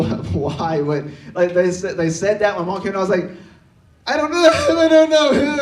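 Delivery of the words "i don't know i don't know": high, loud, hammed-up crying; mock bawling. Kid breaks down in front of his mom, mock bawling. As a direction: wail the words as if bursting into tears. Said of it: "all the tears just flow".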